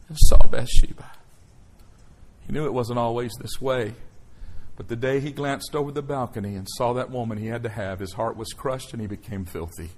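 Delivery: usual speaking voice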